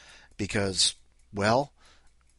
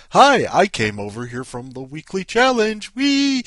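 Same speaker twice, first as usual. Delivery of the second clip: loud, giddy call ending in a high squeal; hammed-up excited visitor announcing themselves; gushing commenter; 7 semitones higher, 11 dB louder than usual. Host voicing a comment on someone's link, loudly giddy. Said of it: "put in the comments".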